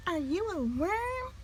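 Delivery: high, soft little bird voice; small bird voice. A narrator's reading. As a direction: ask like a tiny curious chick. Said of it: "he said".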